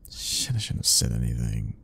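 hushed self-reproach, dropped low; regretful aside. Acted. Regretful aside after the outburst.

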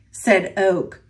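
usual speaking voice

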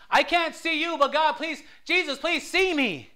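high, loud, pleading cry; crying out to God. Retold or imitated. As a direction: beg Jesus to see him, slow and loud. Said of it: "call out to God".

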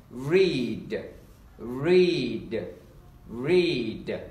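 "read" slowly with a long drawn-out ee; long E demo. Shown as a demonstration. Teacher models read for learners, slow elongated vowel. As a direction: stretch each "read", pausing between. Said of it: "chúng ta đọc là âm long y".

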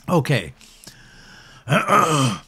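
coughing after tea went down wrong; croaky, throat-clearing after choking; patted on the back. Real, not acted. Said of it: "Something, something went down the wrong pipe there".

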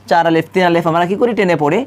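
ordinary talk

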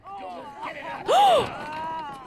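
high, sharp intake of breath; horrified gasp. Real, not acted. Viewer sees an accident on screen, horrified gasp. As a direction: gasp in horror.

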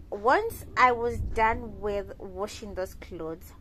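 ordinary talk

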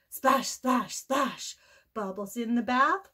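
usual speaking voice

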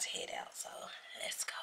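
hushed whisper as she heads out. Real, not acted. To viewers while slipping out quietly, whispering. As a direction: keep it breathy and secret, barely voiced.

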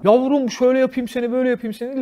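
catcalling man. Acted. Men in a car catcalling three women walking, hammed-up leering delivery. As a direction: pester them with a lewd singsong come-on. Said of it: "laf atmaya başlıyorlar".

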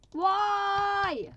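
high, loud plaintive cry; anguished. Real, not acted. Player grieving his parrot, high plaintive cry. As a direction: ask the sky why it happened.

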